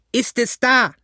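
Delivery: very high, urgent yell; high-pitched bellow; roared question. A narrator's reading. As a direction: shout up demanding an answer. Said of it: "brüllte Pugh".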